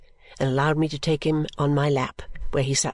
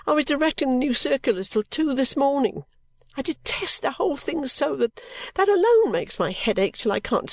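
put-on much higher, worn-out young girl; weary complaining girl; 9 semitones higher than usual. A narrator's reading. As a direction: sound exhausted and put upon. Caddy worn out.

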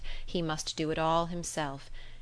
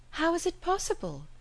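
much higher, amazed question; astonished cry; 12 semitones higher than usual. A narrator's reading. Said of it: "cried Emma".

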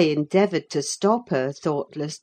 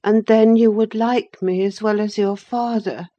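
put-on cheerful boy's voice, a bit higher and louder; more cheerful; 5 semitones higher, 7 dB louder than usual. A narrator's reading. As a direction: brighten up a little as the boy. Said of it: "observed he more cheerfully".

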